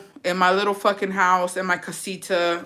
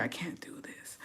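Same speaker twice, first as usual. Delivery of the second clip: softer, unvoiced whisper of "I'm married"; 3 semitones lower, 18 dB quieter than usual. Wife refusing the butcher's kiss, whispering to herself. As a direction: whisper it to yourself, guilty. Repeats line then whispers.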